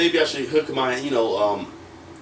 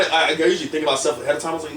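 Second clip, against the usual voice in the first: ordinary delivery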